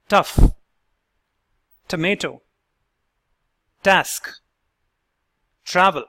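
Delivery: "Tough", "tomato", "task", "travel" each with a crisp aspirated T; slow, careful word-by-word model reading; clear model words. Shown as a demonstration. Accent coach modeling the normal T for learners. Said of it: "Let's hear the words".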